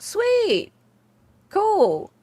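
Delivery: high-pitched, drawn-up exclamations; pleased reaction. Retold or imitated. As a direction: retell being delighted. Retelling own pleased thought.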